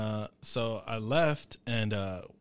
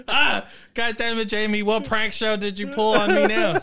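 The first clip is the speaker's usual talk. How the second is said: higher, louder mock outrage at Jamie; exasperated neighbor; 8 semitones higher, 7 dB louder than usual. Drenched neighbor shouts at her husband Jamie, exasperated. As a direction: yell at your prankster husband, soaked.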